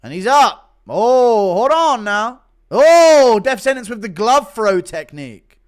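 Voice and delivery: loud, high, excited play-by-play call; fight commentary. Acted. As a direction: open on a big drawn-out cry, then call the action like a commentator.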